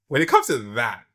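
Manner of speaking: ordinary delivery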